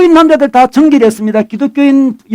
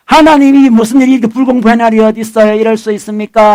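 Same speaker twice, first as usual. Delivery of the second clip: normal talking voice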